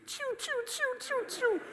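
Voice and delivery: rapid-fire tiny high-pitched sneezes; very high mimicked sneeze burst; imitated multiple sneezes. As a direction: squeak out a volley of little sneezes. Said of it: "these multiple sneezes".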